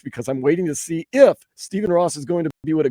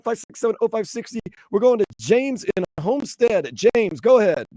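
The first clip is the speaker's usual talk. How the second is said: normal talking voice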